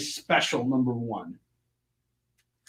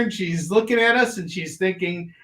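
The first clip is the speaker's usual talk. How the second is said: ordinary talk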